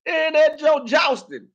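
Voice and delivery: slow, very high shout; hyped shout. Retold or imitated. Mocking the two fighting in the motel room, shouting. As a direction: bellow it out slowly.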